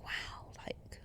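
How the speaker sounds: breathless whispered wow; awed whisper. Retold or imitated. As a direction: whisper it in wonder. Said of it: "making music was like".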